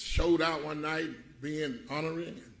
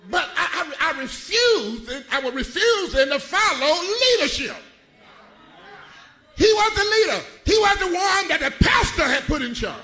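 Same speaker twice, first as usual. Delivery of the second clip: higher, louder preaching shout; preaching at full force; 11 semitones higher, 7 dB louder than usual. Acted. Preacher confessing to the church, shouting sermon. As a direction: build it up and preach.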